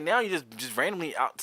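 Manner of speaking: normal talking voice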